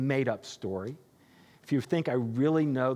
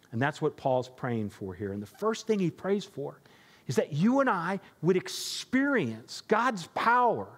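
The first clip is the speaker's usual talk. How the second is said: ordinary talk, nothing marked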